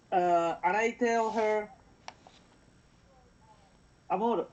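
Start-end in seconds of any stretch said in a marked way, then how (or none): none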